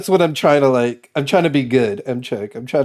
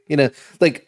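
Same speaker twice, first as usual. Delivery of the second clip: ordinary delivery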